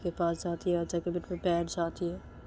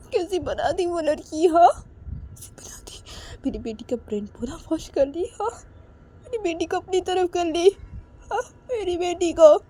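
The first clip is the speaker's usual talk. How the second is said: high-pitched, tearful lament; acted crying; 11 semitones higher, 6 dB louder than usual. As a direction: wail brokenly over your daughter. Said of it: "रोते रोते बोलती". (रोते हुए ऊँची आवाज़ में विलाप)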